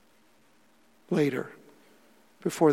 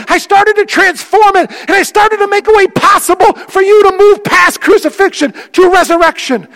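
much higher and louder, a triumphant proclamation; voice of Jesus, preached; 13 semitones higher, 16 dB louder than usual. Acted. Pastor voicing Jesus telling the congregation what he did, fervent declamation. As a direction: speak as the Lord lifting the burden. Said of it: "And he goes".

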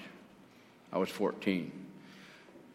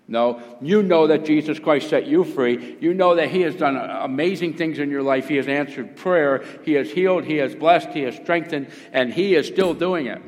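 raised, loud, driving sermon delivery; full-force preaching; 4 semitones higher, 6 dB louder than usual. Acted. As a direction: build a list emphatically for the congregation.

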